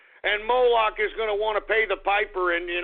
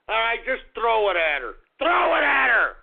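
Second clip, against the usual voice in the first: yelled, higher and louder; angry shout; 5 semitones higher, 4 dB louder than usual. Acted. Ranting host urging fans to throw things, furious shout. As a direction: bellow the order at the stadium crowd. Urging crowd to pelt singer.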